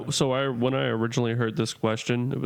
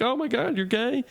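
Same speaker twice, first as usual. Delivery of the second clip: much higher, squealed mock surprise; mock shocked gasp; 13 semitones higher than usual. Radio caller imitating coworkers' shocked reaction, high mock squeal. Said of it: "and everyone will be like".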